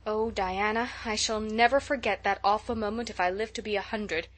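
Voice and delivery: ordinary delivery, nothing marked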